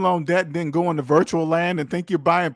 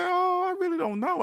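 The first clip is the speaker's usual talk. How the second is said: clueless phone buyer; 13 semitones higher, 5 dB quieter than usual. Acted. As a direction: play dumb in a much higher, softer voice.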